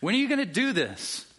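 high, pleading cry; martyrs crying out. Retold or imitated. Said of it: "they're crying out".

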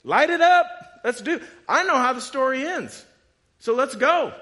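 loud, high, fearless shout; bold martyr's cry. Retold or imitated. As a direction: cry it out like a martyr ready to die. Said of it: "who are said".

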